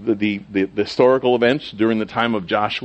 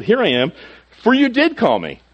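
"did" pushed high and louder in "you did call me"; stress on DID; 11 semitones higher, 3 dB louder than usual. Acted. Samuel insisting to Eli that he was called, stress on DID. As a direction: lean hard and high on "did". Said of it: "I put the emphasis on that".

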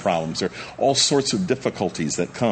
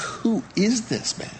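awed wonder; 13 semitones higher, slower than usual. Acted. Disciples awed by calmed storm.

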